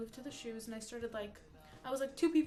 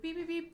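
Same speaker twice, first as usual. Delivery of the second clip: higher mock alarm beeps; imitated beeping; 6 semitones higher than usual. Streamer imitating the shop's exit alarm, mock beeping. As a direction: chirp it like a store alarm. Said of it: "it goes, like".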